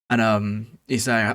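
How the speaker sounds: ordinary talk, nothing marked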